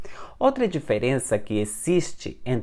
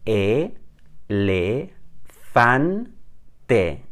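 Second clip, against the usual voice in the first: syllable by syllable; 4 semitones lower, much slower than usual. Shown as a demonstration. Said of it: "Os três Es são pronunciados igual nessa palavra".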